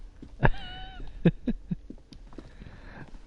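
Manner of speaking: very high breathless laughter; squealing laugh. Real, not acted. Streamer cracks up again.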